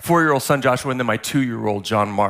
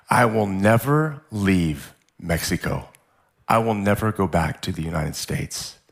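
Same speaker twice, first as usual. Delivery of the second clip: low, heavy-hearted resolve; emotional vow; 5 semitones lower than usual. Real, not acted. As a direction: vow it while holding back real emotion. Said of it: "still emotional now".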